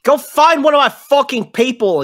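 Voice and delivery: high, loud angry camp boss yelling back; gruff NPC shouting. Acted. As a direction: bark it out like an irate old tinkerer. GM voicing NPC.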